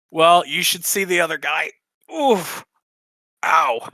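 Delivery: joking, then wincing oof and ow; wry, pained comeback. Acted. Hurt Dolly jokes back at her partner, pained. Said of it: "She, she kinda laughs at this and goes".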